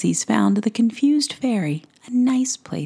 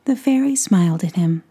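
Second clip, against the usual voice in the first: usual speaking voice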